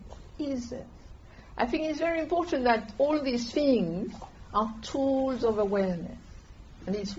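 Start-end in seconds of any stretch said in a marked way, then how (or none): 0.1-0.9 quiet, gently dropped question; quietly asking during listening meditation; soft inner question. Shown as a demonstration. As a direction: barely voice the question. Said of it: "time to time".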